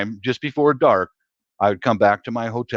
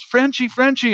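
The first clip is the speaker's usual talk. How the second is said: much higher, sing-song wheedling voice; wheedling ladyboy; 12 semitones higher than usual. Retold or imitated. Ladyboy begging the Frenchie for money, much higher wheedling voice. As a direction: beg sweetly, way up high. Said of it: "and she goes".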